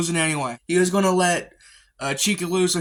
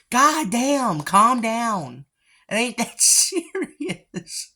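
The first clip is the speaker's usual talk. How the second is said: high, loud, then slow and laughing; laughing mock rebuke; 7 semitones higher, 3 dB louder, much slower than usual. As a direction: playfully tell her to calm down.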